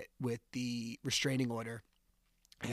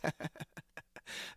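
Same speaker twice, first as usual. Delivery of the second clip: mock laugh, imitating someone taunting; 4 semitones higher, 7 dB quieter than usual. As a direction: imitate a soft, jeering cackle. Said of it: "laughing, like, taunting me".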